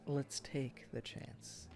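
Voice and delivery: slow, near-whispered plea; soft plea. Acted. Asking his old love for another chance, slow and hushed. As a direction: breathe it to her, slowly.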